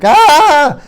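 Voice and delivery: loud, high "Ah!" scream; hammed-up high yell; acted scream. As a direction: scream like a house full of kids at dawn. Acting out waking to screaming.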